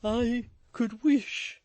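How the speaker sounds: slow, portentous start; ponderous young gentleman; solemn awkward suitor. A narrator's reading. Toots voiced.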